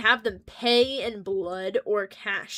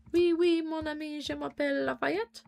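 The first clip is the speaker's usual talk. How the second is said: mock French accent; 4 semitones higher than usual. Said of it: "French Lafayette character voice".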